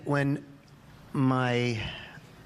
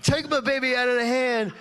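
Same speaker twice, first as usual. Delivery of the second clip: frantic parent; 8 semitones higher than usual. Retold or imitated. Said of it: "ran back and says".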